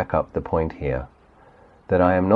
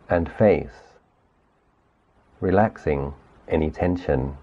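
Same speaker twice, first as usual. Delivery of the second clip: guided meditation. Acted. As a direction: gently lead the listener through the body, unhurried. Leads guided body sweep meditation.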